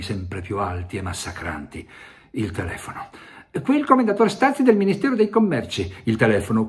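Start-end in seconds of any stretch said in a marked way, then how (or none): 3.6-5.9 much higher, fast, self-important announcement; pompous official caller. Acted. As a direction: announce your rank grandly and quickly. Official announcing himself on phone.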